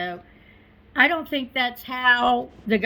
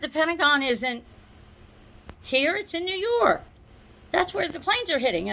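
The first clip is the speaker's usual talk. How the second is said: quoting her in a much higher, insistent voice; high insistent retort; 6 semitones higher than usual. Retold or imitated. Coworker insisting the Pentagon is in New York, high and certain. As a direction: pitch it up high, sure of herself.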